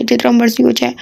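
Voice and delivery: ordinary delivery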